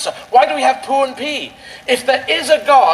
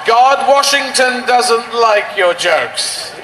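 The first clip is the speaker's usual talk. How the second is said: slow mock heckler; 2 semitones higher, much slower than usual.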